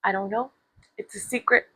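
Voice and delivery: very soft imitation of a drunk man; hushed drunk reply. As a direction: answer almost under your breath, imitating him. Imitates confused drunk man answering.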